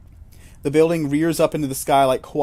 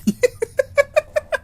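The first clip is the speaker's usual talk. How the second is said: loud, high peal of laughter; 6 dB louder than usual. Real, not acted. Laughter at extra form.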